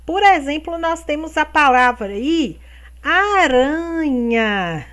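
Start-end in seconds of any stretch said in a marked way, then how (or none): 3.0-4.8 slow drawn-out aranha. Shown as a demonstration. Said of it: "Presta atenção na forma de falar".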